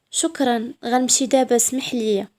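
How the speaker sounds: usual speaking voice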